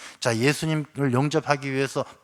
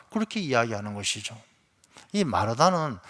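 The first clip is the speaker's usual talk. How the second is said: ordinary talk, nothing marked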